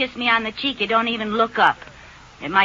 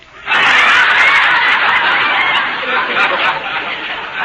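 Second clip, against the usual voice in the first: a high, loud, airy cackle of glee; loud high triumphant laugh; 7 dB louder than usual. Acted. Wife laughs at husband who kissed a grapefruit, loud high glee. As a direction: crow with laughter at the trick.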